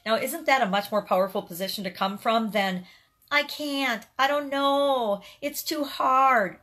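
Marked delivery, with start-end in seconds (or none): 3.3-6.6 high, slow whining complaint; whiny voice. Shown as a demonstration. Mock whine at the viewer about giving up. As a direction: whine it out like a sulky kid. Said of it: "that's not my best whiny voice".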